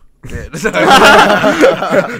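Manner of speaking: big, long, high laugh; loud burst of laughter. Real, not acted. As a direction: burst out laughing mid-advice. Bursts out laughing after his advice.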